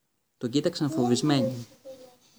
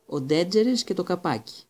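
sneering; 6 semitones higher, 4 dB louder than usual. A narrator's reading. Malfoy sneers.

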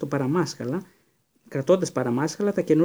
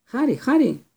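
higher, louder call across the street; 10 semitones higher, 5 dB louder, slower than usual. A narrator's reading. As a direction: shout his name to get his attention. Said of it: "άκουσε να φωνάζουν το όνομά του". (φωνάζει δυνατά το όνομά του)

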